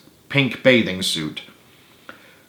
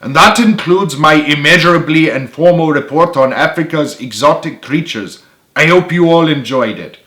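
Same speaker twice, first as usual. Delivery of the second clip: amateur Scottish lilt, higher and louder; hammed-up Scottish student lecturer; Scottish accent; 4 semitones higher, 4 dB louder than usual. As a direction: give a presentation in a rough Scottish accent. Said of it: "not the best at doing a Scottish accent".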